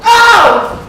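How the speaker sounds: sharp loud high cry of pain; pained yelp. Acted. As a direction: yelp as the foot is wrenched. Servant plucks foot awry.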